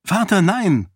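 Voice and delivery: high, urgent exclamation; cried out in protest. Acted. Daughter objects to father's rescue plan, high protesting cry. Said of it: "rief Sarene".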